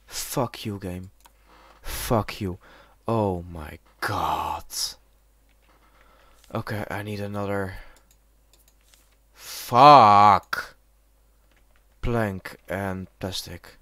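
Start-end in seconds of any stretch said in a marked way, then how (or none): 0.0-4.6 low-energy, sinking exasperation; defeated cursing. Real, not acted. 9.3-10.8 loud, drawn-out curse; frustrated swearing. Real, not acted. Frustrated with the game.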